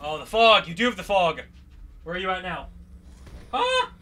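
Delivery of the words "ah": very high short "ah"; startled yelp. Real, not acted. Player surprised by animatronic, startled yelp. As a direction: yelp as if caught off guard. Jump scare in the game.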